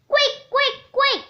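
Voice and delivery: quick, high, urgent calls. Acted. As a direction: hurry her along, voice up high. BFG urging Sophie to hurry.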